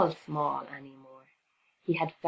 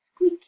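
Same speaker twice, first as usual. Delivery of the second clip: very high loud squeak; tiny nervous mouse squeak; 12 semitones higher, 8 dB louder than usual. A narrator's reading. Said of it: "mouse's nervous squeak".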